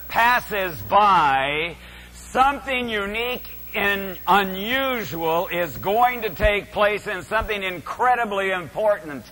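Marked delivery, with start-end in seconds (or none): none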